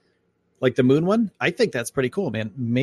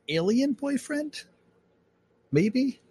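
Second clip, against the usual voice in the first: mock high teasing voice; 14 semitones higher, much slower than usual. Teasing about alien boyfriend.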